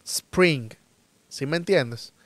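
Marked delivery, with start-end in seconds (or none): 0.0-0.7 "spring" begun directly on the S hiss. Shown as a demonstration. Teacher drilling learners, "spring" with a clean hissed S. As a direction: start "spring" with S, no vowel before it. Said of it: "con el sonido ese de la serpiente".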